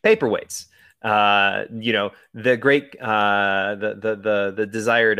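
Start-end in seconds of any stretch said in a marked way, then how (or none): 0.0-0.7 Paperweights. said high and incredulous; amused disbelief. Real, not acted. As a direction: pitch jumps up in amused disbelief.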